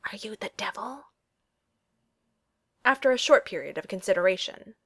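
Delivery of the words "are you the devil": stage whisper; loud whisper. Acted. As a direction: whisper it loud enough to be heard. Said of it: "turned to whisper loudly".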